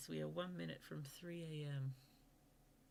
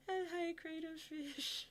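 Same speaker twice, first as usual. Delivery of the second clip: high, drawn-out squeaky hello; much higher, stretched-out greeting; delighted greeting; 13 semitones higher, 4 dB louder, slower than usual. Real, not acted. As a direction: greet a friend in a high, slow singsong. Greeting a viewer joining the stream.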